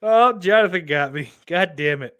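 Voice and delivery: normal talking voice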